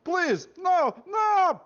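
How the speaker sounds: very high, loud hammed-up shriek; mock terrified scream. Victim screaming before shot.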